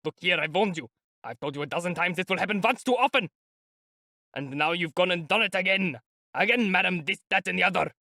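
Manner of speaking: high, raging tirade; angry lieutenant. A narrator's reading. Lieutenant berating the opulent lady in a rage. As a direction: berate her like a furious officer.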